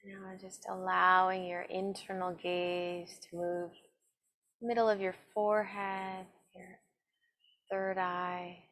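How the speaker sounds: slow, calm meditation voice with long pauses; guided meditation. Acted. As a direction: guide them gently inward, evenly and unhurried. Leads a seated meditation.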